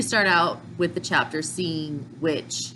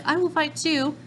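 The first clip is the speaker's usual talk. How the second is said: excited imitation; 12 semitones higher than usual. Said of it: "And then Khalid's like".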